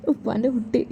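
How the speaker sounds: father hushed and tearful over his daughter. Acted. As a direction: murmur "ഉപ്പാൻ്റെ കുട്ടി" softly, voice near crying. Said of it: "ഉപ്പ കരയല്ലേ".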